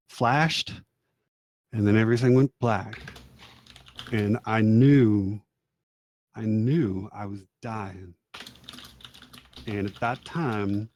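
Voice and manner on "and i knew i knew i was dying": grave, drawn-out recollection. Acted. Character recounts her near death.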